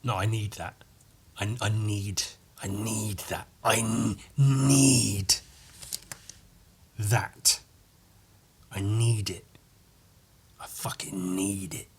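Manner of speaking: soft, drawn-out muttering to himself; quiet inner craving. Retold or imitated. Shopper quietly talking himself into buying, hushed. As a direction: crave it in a low private voice. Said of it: "I thought to myself".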